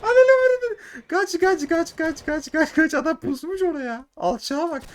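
very high, loud shrieked run run; frantic screaming to escape; panicked scream. Real, not acted. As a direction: flee in sheer panic.

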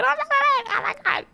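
very high-pitched excited squeal; thrilled squeal. Real, not acted. Streamer thrilled at being paid, squealing with excitement. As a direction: let the excitement burst out way up high.

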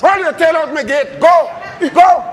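shouting him out. Acted. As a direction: drive him out the gate. Son orders stepfather out.

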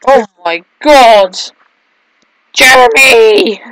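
slow, loud anguished calling out; horrified cry. Real, not acted. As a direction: cry out the name in dismay. Character dies, calling name.

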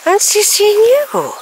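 high, slow coo to the horse; sing-song greeting to an animal; pet talk. Real, not acted. Talking to a horse, high slow coo.

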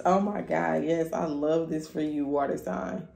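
ordinary delivery

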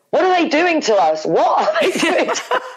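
high, laughing mock panic; giddy disbelief. Mother mocking her own panic, high and laughing. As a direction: squeal it out half laughing. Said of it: "talk about going from snotting and crying".

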